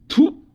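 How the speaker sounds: a sharp high "thoo" of contempt; disgusted spitting sound. Acted.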